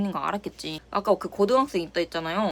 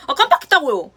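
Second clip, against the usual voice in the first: loud, higher, talking back; defiant snap; 6 semitones higher, 9 dB louder than usual. Pretend. Pretend comeback to the shouting manager, loud and defiant. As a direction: throw the excuse back at him. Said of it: "이렇게 할 순 없잖아".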